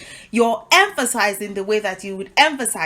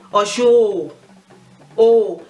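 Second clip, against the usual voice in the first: "Oshun, oh, oh" with loud, drawn-out ohs; emphatic double oh; 5 dB louder than usual. Shown as a demonstration. Teacher showing how to emphasize a name, emphatic double oh. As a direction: press on both "oh"s after "Oshun". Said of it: "teach you a different way of emphasizing".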